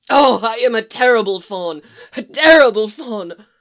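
loud, breaking self-reproach; anguished wail. Acted. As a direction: cry it out in despair. Tumnus wails his self-blame.

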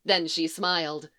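usual speaking voice

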